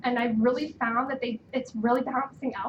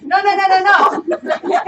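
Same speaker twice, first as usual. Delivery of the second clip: loud, slow, high laughing denial; 7 semitones higher, 13 dB louder, much slower than usual. Retold or imitated. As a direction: laugh through the repeated no.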